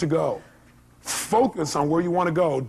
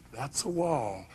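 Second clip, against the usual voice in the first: mock fixated; 3 semitones lower, 9 dB quieter, much slower than usual. Staring at the problem, wrong way.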